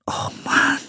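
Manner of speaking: whispered, miserable groan; hushed, voiceless dismay; unhappy whisper. A narrator's reading. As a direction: whisper it in dejection. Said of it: "flüsterte er unglücklich".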